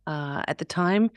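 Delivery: ordinary delivery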